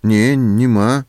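slow, dull and muffled. A narrator's reading. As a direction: answer in a hollow, muffled voice. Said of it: "глухим голосом ответил человек".

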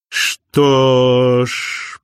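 long, stretched-out words; lingering, drawled lead-in; drawn-out. A narrator's reading. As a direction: draw each word out slowly. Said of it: "протянул Винс".

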